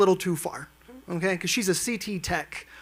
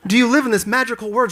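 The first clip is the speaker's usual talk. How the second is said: mock amazement; 6 semitones higher, 6 dB louder than usual. Mock-incredulous husband.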